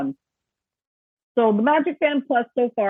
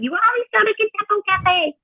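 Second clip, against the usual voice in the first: mock chiding in a much higher voice; imitating someone's nagging voice; 12 semitones higher than usual. Hearing a friend's voice in her head at lunch, mock nagging. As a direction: jump up high and mimic a friend's complaint. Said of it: "all I could hear was somebody's voice going".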